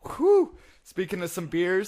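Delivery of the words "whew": a loud, high blown-out whew; a big relieved whoosh of breath, much higher and louder. Real, not acted. Exhale after strong rum drinks.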